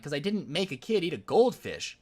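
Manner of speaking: ordinary delivery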